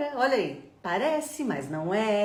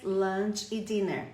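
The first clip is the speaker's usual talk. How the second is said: usual speaking voice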